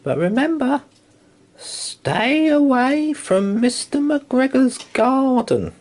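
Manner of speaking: high, slow, loud warning; mother rabbit warning. Acted. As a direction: warn the children high and slowly. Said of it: "she told her children".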